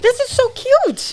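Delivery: high, loud, slow gushing; real delight, high and drawn out; delighted squeal. Real, not acted. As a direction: gush over the cute can. Delighted at the can design.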